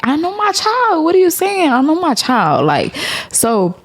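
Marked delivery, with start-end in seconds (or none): 0.0-2.6 high, outraged; indignant. Retold or imitated. Mom venting to viewers about doctors, pitched high in outrage. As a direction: she knows her child, be outraged. Indignant at hospital colic verdict.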